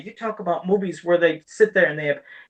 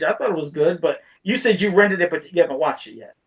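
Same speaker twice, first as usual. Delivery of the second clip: normal talking voice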